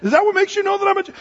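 loud, high and fast, rising in disbelief; indignant retort. Retold or imitated. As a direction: demand an answer, outraged. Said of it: "He says".

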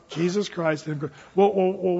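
normal talking voice

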